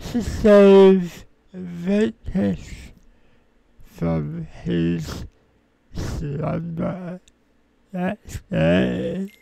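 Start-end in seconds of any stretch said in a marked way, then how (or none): none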